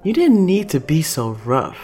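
hurt, whiny objection; pouting complaint. Acted. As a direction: pout it like a scolded kid. Angelica pouts at Chase.

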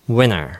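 "winter" said as "winner", no T at all; T dropped. Shown as a demonstration. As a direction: drop the T so "winter" sounds like "winner". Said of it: "winnerと同じように発音". (Tを落として「winner」と発音)

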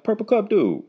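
high-pitched fan calling out; excited fan. Retold or imitated. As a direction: call it out like a fan spotting someone. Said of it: "they be like".

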